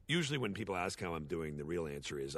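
ordinary talk, nothing marked

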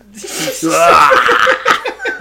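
loud, laughing yell; mock cocaine-fuelled scream. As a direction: scream like he just took a hit. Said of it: "Why is he screaming".

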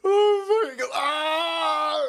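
high, loud shocked cry. Real, not acted. Streamer crying out at the crash. Reacting to the video clip.

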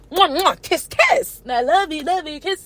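put-on high-pitched kissy baby talk; exaggerated high kissy voice; mock lovey-dovey. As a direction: squeal smooches in mock adoration. Mocks a couple kissing.

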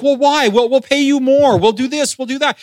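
insistent coaxing. Retold or imitated. Church callers trying to win over a refusing candidate, insistent coaxing. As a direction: press them eagerly, pitched well up.

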